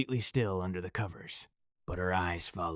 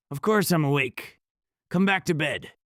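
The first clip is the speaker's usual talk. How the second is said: an eerie voice, higher and louder, not her own; something pretending to be her; too deep, wrong voice; 8 semitones higher, 7 dB louder than usual. Possessed girlfriend insisting he come back, eerie and louder. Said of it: "that too deep, wrong voice".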